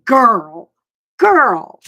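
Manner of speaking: loud, drawn-out exclamation of approval; emphatic admiration. Real, not acted. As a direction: punch it out, impressed. Admiring reaction to star's outfit.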